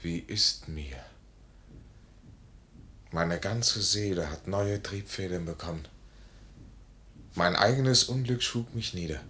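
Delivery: leiser, tiefer, nachdenklich; leiser Monolog. Acted. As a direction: spricht sich selbst leise Mut zu. Selbstgespräch des Majors allein. (leises Selbstgespräch)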